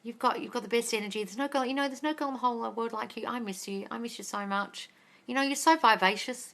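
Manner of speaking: soft mock version of the ex's words; imitating ex on phone. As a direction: hush it like a smitten ex pleading. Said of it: "I just sat on the phone like".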